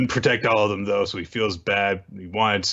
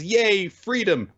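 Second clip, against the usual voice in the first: mock cheer; 10 semitones higher, slower than usual. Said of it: "mock cheer".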